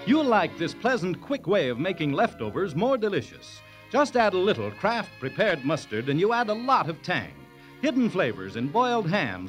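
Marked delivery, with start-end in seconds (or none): none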